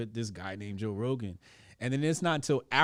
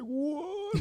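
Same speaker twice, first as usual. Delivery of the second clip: high squeaky disbelieving what; shocked what. Retold or imitated. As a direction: mimic their stunned disbelief. People reacting to Rogan news.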